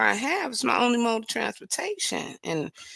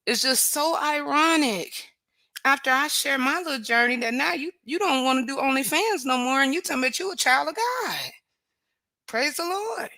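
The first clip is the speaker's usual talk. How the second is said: sarcastic mock praise; 6 semitones higher than usual. Sarcastic jab at ex-OnlyFans woman.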